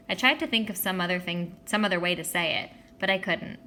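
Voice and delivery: ordinary delivery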